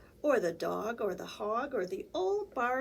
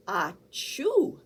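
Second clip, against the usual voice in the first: sneeze; 4 dB louder than usual. Acted. Sneeze acted.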